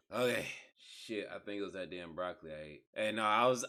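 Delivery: normal talking voice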